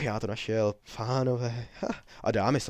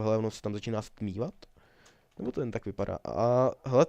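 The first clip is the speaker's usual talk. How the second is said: usual speaking voice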